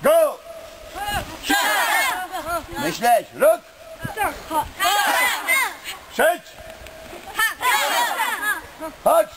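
shouted dojo commands; shouted drill count. Real, not acted. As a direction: call the count like a sensei.

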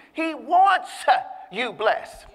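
"wants" lifted high and punched; stress on wants. Acted. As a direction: lean hard into "wants". Preacher stressing God's will.

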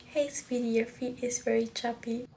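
normal talking voice

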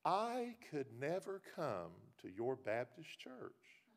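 a lightly voiced lady turning him down slowly; imitating the lady. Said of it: "That's the way she said it".

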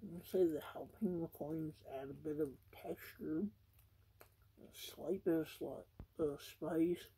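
chewing while talking; talking between bites; mouth full. Real, not acted. Seen 1: bit burger just before; jaw still working while talking.

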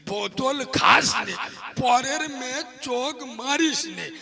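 a hint of a pious village imam coaxing his flock; village maulvi's advice. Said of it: "ইমামরা বলে".

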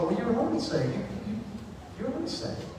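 hushed and hesitant, lifting at the end; puzzled wife. Retold or imitated. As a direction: imitate a baffled wife speaking quietly. Puzzled wife tries to reassure him.

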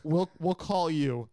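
mock dismissive staffer. Mimicking the urgent care guy, mock brush-off. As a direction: drawl it like a bored official.

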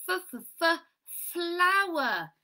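high, emphatic f, f, f before FLOWER; repeated initial sound. Shown as a demonstration. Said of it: "What's today's sound?".

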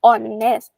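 honest starting straight on the O, H dropped; silent H. Shown as a demonstration. Teacher showing students a vowel-sound word, honest with silent H. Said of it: "ไม่ต้องออกเสียงตัว H".

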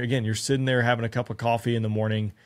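usual speaking voice

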